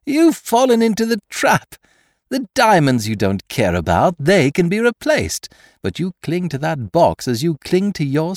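high, gleeful gloating; shouting with laughter. A narrator's reading. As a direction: crow over the man in your trap. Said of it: "shouting with laughter".

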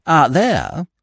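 understated regretful admission; mildly rueful; manly regret. A narrator's reading. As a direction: concede it with a touch of regret. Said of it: "a touch of manly regret".

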